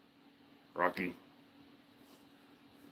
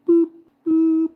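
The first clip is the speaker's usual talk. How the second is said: much higher, louder squeaky boops; playful boops; 10 dB louder than usual. Real, not acted. Booping his dog's snoot, loud high squeaks. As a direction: squeal it as you boop the snoot.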